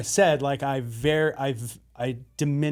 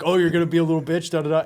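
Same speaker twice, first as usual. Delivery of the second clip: louder, quick teasing imitation; mock taunting; 2 semitones higher, 5 dB louder, faster than usual. Accountability group calling out a friend, mock taunting. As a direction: lightly mimic friends calling you out. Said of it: "and then they're like".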